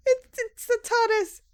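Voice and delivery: high, loud, slow near-tearful squeal; overjoyed cry. Acted. The newcomer cries with joy at the door. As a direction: cry it out nearly weeping with joy.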